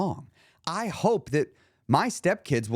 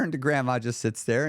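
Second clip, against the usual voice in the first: ordinary delivery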